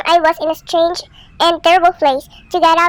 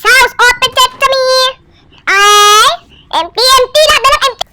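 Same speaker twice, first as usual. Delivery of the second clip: frustrated; 6 semitones higher, 4 dB louder than usual. Real, not acted. Frustrated at empty houses.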